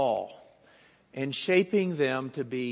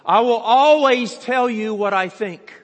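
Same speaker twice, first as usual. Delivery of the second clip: higher, much louder, leaning on always; emphatic declaration; 7 semitones higher, 10 dB louder than usual. Retold or imitated. Preacher voicing the tiny assistant stating her first rule, emphatic. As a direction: proclaim it like a stern boss.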